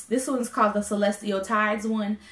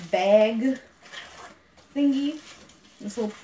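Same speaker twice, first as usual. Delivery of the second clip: talking through the hiccups; hiccuping between words. Real, not acted. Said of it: "I have the hiccups".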